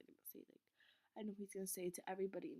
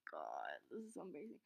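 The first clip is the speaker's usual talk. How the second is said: mortified murmur; 7 semitones lower, 9 dB quieter, faster than usual. Retold or imitated. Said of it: "I was like".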